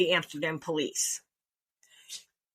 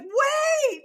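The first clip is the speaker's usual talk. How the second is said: high, loud cry of Wait; desperate shout; 6 dB louder than usual. Retold or imitated. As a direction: yell after friends leaving you. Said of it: "I'm like".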